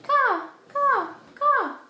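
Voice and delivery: crow caw. Shown as a demonstration. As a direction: caw three times like a crow, showing the dog. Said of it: "You do it".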